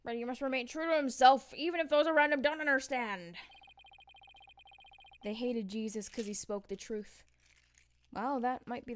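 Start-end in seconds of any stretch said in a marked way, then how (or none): 0.0-3.3 loud. Acted. Character line voiced grandly.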